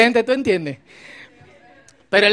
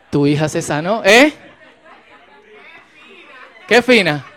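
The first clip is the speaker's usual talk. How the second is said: ordinary talk, nothing marked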